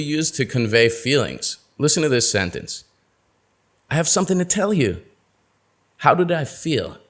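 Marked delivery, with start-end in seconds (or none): 3.9-5.0 high, fast and eager; bursting to share good news; excited announcement; happy and excited. Shown as a demonstration. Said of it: "happy and excited".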